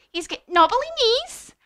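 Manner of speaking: high, loud mouse voice; mouse describing a monster. Acted. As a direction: build up each odd feature. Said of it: "he said".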